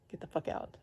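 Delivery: fierce whisper; whispered command. Retold or imitated. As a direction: spit it out in a whisper. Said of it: "yelling at him".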